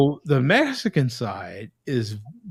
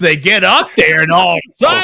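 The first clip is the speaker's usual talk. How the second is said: shouting like a fired-up preacher; 2 semitones higher, 8 dB louder than usual. Retold or imitated. Nephew mimicking converts preaching, mock preacher shout. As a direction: preach it loud from the pulpit. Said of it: "they talking like this".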